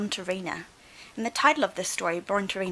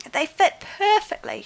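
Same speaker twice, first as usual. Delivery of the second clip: high, louder joyful cry; 9 semitones higher, 7 dB louder than usual. Acted. Dinosaur thrilled by her new shoes, joyful cry. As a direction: burst with delight at the shoes. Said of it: "she cried".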